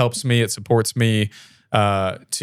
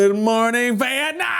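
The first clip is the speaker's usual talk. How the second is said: higher, drawn-out mock radio shout; 12 semitones higher than usual. Host doing the famous radio greeting as a high, drawn-out shout. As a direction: belt it like a manic DJ.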